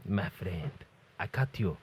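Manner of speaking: low, menacing cartoon Spaniard; mock Spanish accent. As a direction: threaten in a hammy Spanish accent. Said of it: "with a Spanish accent".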